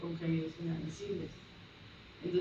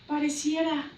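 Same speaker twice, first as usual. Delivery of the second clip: alarmed news; 3 dB louder than usual. Retold or imitated. Panicked relative on phone.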